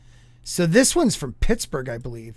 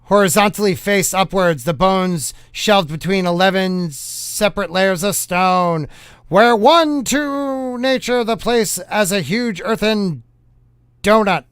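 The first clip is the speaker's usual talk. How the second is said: put-on punchy old-time newsreel announcer; hammed-up fifties newscaster read; 1950s news voice. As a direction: proclaim it like a vintage news bulletin. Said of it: "read it in a nineteen fifties news style voice".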